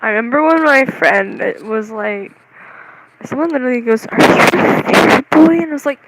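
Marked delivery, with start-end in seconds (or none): 4.1-5.5 loud, higher mock questioning; imitated loud question. Retelling someone's question to a friend, loud. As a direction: call it out as someone else's question.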